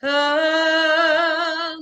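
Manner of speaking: high, loud sustained vowel; indignant angry oh. Shown as a demonstration. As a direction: let indignation ring out on a long "oh". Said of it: "angry and indignant".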